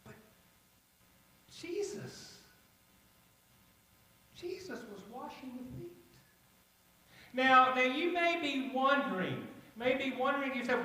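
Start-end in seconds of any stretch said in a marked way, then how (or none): 0.0-5.9 very soft, half-whispered disbelief; almost whisper it, in awe; hushed amazement. Acted. Peter marvels at Jesus serving them.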